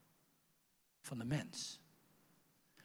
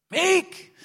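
high loud call across a room; mock calling for his wife; 12 dB louder than usual. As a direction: shout her name to find her.

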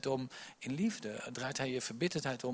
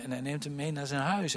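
normal talking voice

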